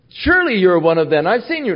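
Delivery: high, loud accusation; loud accusing bystander. Acted. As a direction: point the finger, pitch rising. Accusers confront Peter.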